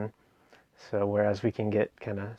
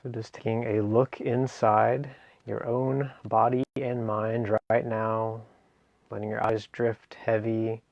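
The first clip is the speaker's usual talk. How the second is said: guided meditation. Acted. Meditation teacher guiding listeners through a seated relaxation, guided meditation. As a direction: ease them gently through each phrase, leaving space between.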